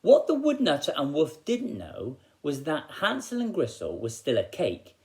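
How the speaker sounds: ordinary delivery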